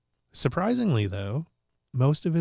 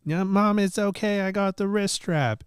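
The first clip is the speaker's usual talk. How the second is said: put-on higher, louder kid protesting; imitating little nephew; 10 semitones higher, 4 dB louder than usual. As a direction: play the five-year-old brushing off mom. Nephew voiced in a story.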